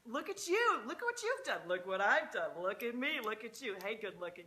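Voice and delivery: loud, high gushing over a soulmate; gushing delight. Pretend. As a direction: swoon loudly at your soul family. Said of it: "and you're just like".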